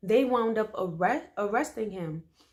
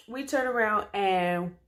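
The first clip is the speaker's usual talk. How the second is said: ordinary delivery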